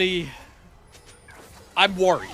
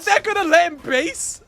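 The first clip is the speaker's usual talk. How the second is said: a high, loud, laughing shout of disbelief; disbelieving shout; 13 semitones higher, 9 dB louder than usual. Real, not acted. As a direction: yell it in shock at the screen. Unbelievable base escape on stream.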